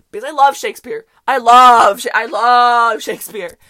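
big, loud burst of love for Shakespeare; loud and slowed on the last line; loud gushing. Retold or imitated. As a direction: practically shout your devotion. Gushing loudly to confused classmates.

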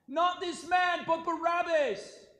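high, very loud shout, stretched at the end; crowd's cry. A narrator's reading. Crowd answers Pilate's offer of release, crying out. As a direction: cry it out as an angry mob. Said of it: "they cried out".

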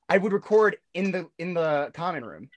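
normal talking voice

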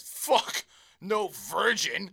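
put-on loud, drawn-out drunk protest; retold drunk outburst, stretched out; plastered customer. Drunk customer correcting the bartender's virgin joke, loud drunk protest. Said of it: "these guys just plastered".

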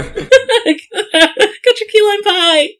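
very high, slow and squeaky; high squeaky waiter voice. Acted. Waiter voices cheering him on.

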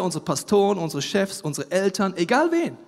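normal talking voice

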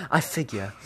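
low, soft resigned mutter to himself; sighing. A narrator's reading. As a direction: let it drop low and quiet, like a sigh. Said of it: "Keaton s-, then sighs".

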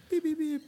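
high, quiet mimicked beeping tone; phone beeps. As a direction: mimic the hold line beeping high and soft. Imitating phone hang-up tone.

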